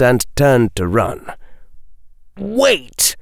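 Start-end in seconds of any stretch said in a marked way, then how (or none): 2.4-3.1 high-pitched urgent call; higher cry. A narrator's reading. Hooded figure calling after fleeing Daphne, high cry. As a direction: cry out high to stop someone leaving. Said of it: "cried the voice, now higher and louder".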